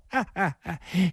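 high, staccato stage laugh; acted tittering laugh; sudden laugh. A narrator's reading. Fat woman covers her anger with a sudden laugh. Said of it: "突然又笑了".

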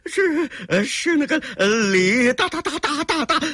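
high stammer stuck on one syllable; panicked stutter. Acted. Cornered villain tries to name the boss, terrified stutter on 大. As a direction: get stuck on 大, rattling it out in one breath. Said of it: "他一口气儿说了十好几个大".

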